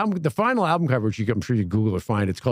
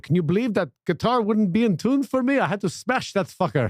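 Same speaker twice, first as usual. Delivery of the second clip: high-pitched mock rock-star rant in a put-on accent; imitated self-important guitar hero; 9 semitones higher than usual. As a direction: rant like a showman still in his stage bit. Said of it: "he's still, like, living the bit".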